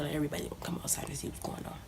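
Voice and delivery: fast, hushed gossip whisper. Real, not acted. Whispering to viewers about nosy neighbours, fast and hushed. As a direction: rattle it off under your breath.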